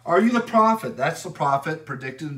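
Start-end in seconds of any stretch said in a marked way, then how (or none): 0.1-1.0 loud challenging question. Acted. Priests questioning John the Baptist.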